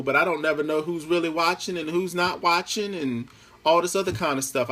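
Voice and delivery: ordinary talk, nothing marked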